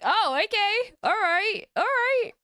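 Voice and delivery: high-pitched, wary surprise; mock-startled, pitched well up; taken aback. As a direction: react in a high voice to unexpected drama. Reacting to commenters arguing.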